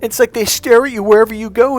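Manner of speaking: high, loud mock woman's voice; hammed-up girlfriend spooked by stares; imitating upset ex-girlfriend. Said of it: "She goes".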